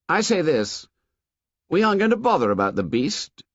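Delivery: a hint of Jack laying down the law; Jack voiced. A narrator's reading. Jack telling the hunters to forget the beast, commanding.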